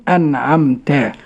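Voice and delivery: drawn-out أنعمت with clear still nun; correct pronunciation. Shown as a demonstration. Student showing listeners correct أنعمت, slow and clear. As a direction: say أنعمت carefully, an-am-ta, all light. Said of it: "النطق الصحيح".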